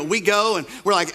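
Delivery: normal talking voice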